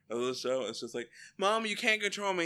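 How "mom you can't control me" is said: put-on bratty teen daughter. Voicing Betty defying her mom.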